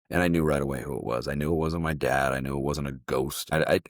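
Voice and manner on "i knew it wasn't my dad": normal talking voice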